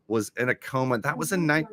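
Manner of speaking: usual speaking voice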